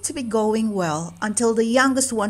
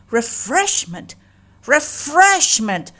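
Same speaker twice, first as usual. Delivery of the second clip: outraged; 6 semitones higher, 4 dB louder, slower than usual. Acted. Cat outraged at guests fed first.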